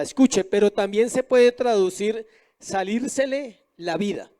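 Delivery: ordinary talk, nothing marked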